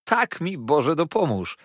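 jubilant, emphatic confirmation; merry exclamation. A narrator's reading. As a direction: swear it gleefully, without laughing. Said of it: "śmiał się wesoło".

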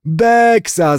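high-pitched mild exclamation; grandfather calling out. A narrator's reading. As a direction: throw it out high as a teasing old man. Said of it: "zawołał dziadek".

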